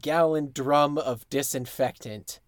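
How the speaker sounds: ordinary delivery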